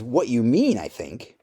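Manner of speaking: ordinary delivery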